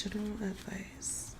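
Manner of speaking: "General advice" breathed in a soft whisper with no voice. Shown as a demonstration. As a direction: whisper the words to yourself. Repeats the question, then whispers it.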